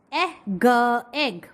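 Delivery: Eh and g said apart, then egg; blending sounds. Shown as a demonstration. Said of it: "like this".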